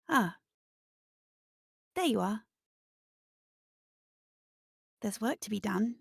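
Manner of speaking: hint of a dark, motherly presence, with long pauses; dark maternal voice. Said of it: "dark, deep voice. Comforting. Almost m-, maternal".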